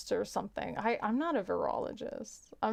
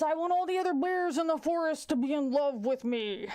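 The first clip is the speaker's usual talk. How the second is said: pushy joke bear; 6 semitones higher, 3 dB louder than usual. Acted. Bear wishing to be king, big pushy voice. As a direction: play a bear greedily barging in first. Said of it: "The bear says".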